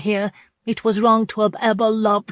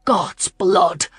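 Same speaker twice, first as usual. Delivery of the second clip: 1 furious curse; 3 dB louder than usual. A narrator's reading. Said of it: "he cursed furiously".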